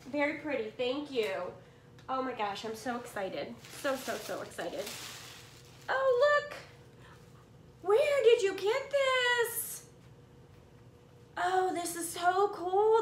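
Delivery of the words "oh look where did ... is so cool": much higher, louder, near tears of joy; thrilled squeal. Real, not acted. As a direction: gasp with delight at the gift. New gift surprises her.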